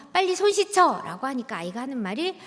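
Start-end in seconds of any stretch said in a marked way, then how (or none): 0.1-1.0 high, loud order to wash; nagging mother. Retold or imitated. Mother ordering her hurt child to wash, high and loud. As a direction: bark it like an impatient mom.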